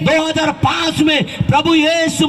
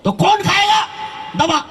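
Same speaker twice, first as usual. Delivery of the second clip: challenging shout; 7 semitones higher than usual. Retold or imitated. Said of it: "बोला".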